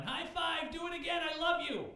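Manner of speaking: high, shouting eager dog voice; excited dog. Pretend. Dog running back to owner, overjoyed and shouting. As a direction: yap it out like a thrilled puppy. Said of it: "come running back going".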